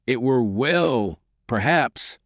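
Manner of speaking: slow, understated Scottish lilt; hint of a Scottish brogue, unhurried; Scottish accent. A narrator's reading. Fletcher cutting Ferguson short, light Scottish accent. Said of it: "his accent abundantly proclaiming him".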